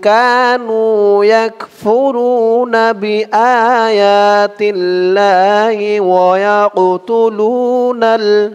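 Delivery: slow, melodic tajweed chant; slow Quranic recitation. Shown as a demonstration. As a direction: recite it drawn out and sung.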